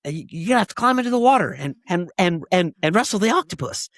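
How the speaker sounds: excited, fast, high-pitched director voice; breathless high Ed Wood impression; quoting Ed Wood. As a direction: rattle it off like an eager director. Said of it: "Ed Wood's like".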